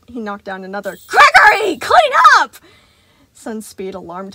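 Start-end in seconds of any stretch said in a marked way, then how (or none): 1.1-2.6 very high, loud shriek; frantic order. A narrator's reading. Sun shrieking at Gregory to clean up. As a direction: shriek the command.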